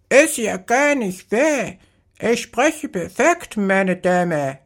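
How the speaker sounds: a parrot insisting it speaks perfectly; shrill-pitched parrot, prim and offended; indignant parrot. Acted. Talking parrot protests.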